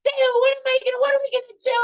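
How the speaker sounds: very high distraught wail; screaming, crying. Retold or imitated. Overwhelmed mother to David in the living room, screaming and crying. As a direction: wail it out in a frantic, high-pitched panic. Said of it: "And I'm screaming, crying".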